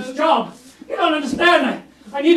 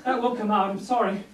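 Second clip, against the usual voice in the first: ordinary talk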